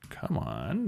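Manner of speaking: normal talking voice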